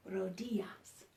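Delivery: whispered echo of "Rodillas"; whispered word. Shown as a demonstration. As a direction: whisper "Rodillas" as ro-DEE-yas. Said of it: "here's another Spanish word".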